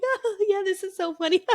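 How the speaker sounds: high, fake cheerful voice; pretending it's funny while in pain; fake laugh. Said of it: "I'm like".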